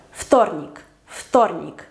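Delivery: "вторник" starting with voiceless f; devoiced first consonant. Shown as a demonstration. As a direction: say "вторник" as ftornik. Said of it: "Su pareja es la F".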